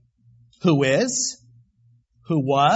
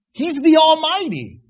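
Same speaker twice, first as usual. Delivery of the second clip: Almighty cried out high and loud, peak of the sermon; proclaimed loud; 11 semitones higher, 9 dB louder than usual. Shown as a demonstration. Preacher crying the name of God to the congregation, loud and high. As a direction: shout He's the Almighty to the rafters. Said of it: "you really capsulize the word with Almighty".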